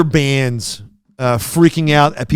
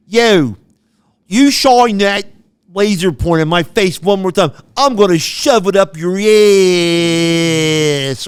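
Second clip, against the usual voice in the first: put-on tough New York accent, louder and punchy; thick New York accent; 2 semitones higher, 4 dB louder, slower than usual. Rock star warns a fan with a laser pointer, in a loud New York accent.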